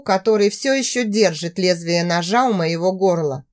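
ordinary delivery, nothing marked

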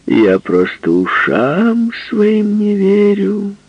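high, slow, drawn-out teasing; squinting tease. Acted. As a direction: stretch it out, winking. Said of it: "повторила она, прищурив один глаз".

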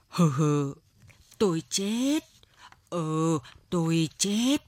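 put-on weak old man, slow drawn-out groan; feeble gasping murmur. A narrator's reading. Exhausted old man answering his wife, feeble drawn-out murmur. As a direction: drag the words out as if spent. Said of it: "Lão thều thào".

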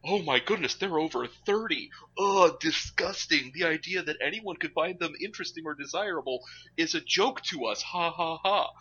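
sneering, disgusted mock voice. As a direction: scoff like a snobby show. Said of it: "folks will go a big old, like".

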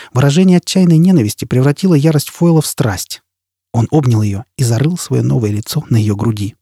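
plain reading voice

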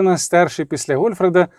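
ordinary delivery

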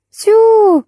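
high loud surprised exclamation; surprised Tyu. A narrator's reading. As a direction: throw up hands in surprise. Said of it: "всплеснула руками торговка".

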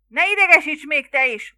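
loud, high shout back; irritated yell. A narrator's reading. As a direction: yell back, thoroughly annoyed. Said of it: "ordította vissza Larry". (ingerülten visszaordítva)

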